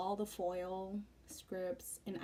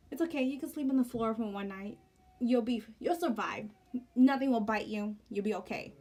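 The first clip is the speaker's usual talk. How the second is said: mock comfort; 3 semitones higher, 9 dB louder than usual. Telling husband to sleep on floor.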